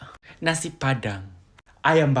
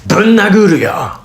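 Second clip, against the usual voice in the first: put-on angry outburst; mock threat; 3 semitones higher, faster than usual. Threatening a punch in Japanese, mock-angry. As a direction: threaten them in mild mock anger.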